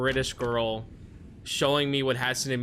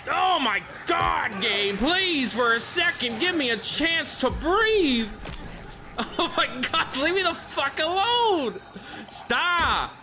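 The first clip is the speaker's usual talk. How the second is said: frantic shout; 14 semitones higher, slower than usual. Real, not acted. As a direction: scream for the game to let up. Swarmed by monsters in game.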